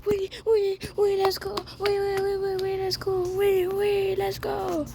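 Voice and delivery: high excited yes, yes; excited cheering. Real, not acted. Celebrating win in game.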